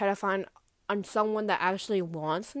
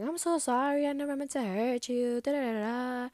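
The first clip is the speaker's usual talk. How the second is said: mocking whiny apology; 4 semitones higher than usual. Mocking his fake apology.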